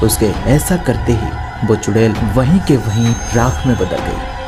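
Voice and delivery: ordinary delivery, nothing marked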